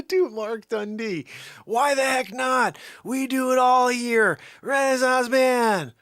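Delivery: loud, high, slow hype call; loud high-spirited proclamation; excited hype. Real, not acted. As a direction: pump up the crowd. Hyping himself up.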